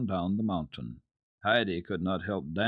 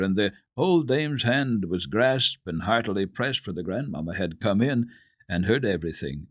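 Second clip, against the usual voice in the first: usual speaking voice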